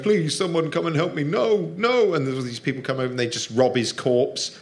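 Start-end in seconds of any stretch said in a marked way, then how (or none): 0.0-2.1 pleading man. Retold or imitated. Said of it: "his thoughts as he's going".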